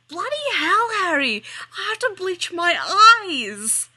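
high, loud disgusted yell. Acted. Weasley catching the kiss, yelling in disgust. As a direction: bellow it in horror. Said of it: "Weasley yells".

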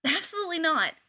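sudden loud refusal; blurted refusal. A narrator's reading. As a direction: blurt it out, caught off guard. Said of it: "he blurts out".

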